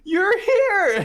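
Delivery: very high, loud delighted cry; mom overjoyed. Retold or imitated. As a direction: burst out like a thrilled mom. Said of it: "she goes".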